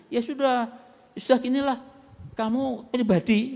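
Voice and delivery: fed-up pastor. Retold or imitated. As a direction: wave the matter off, annoyed. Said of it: "saya bilang".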